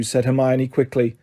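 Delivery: ordinary delivery, nothing marked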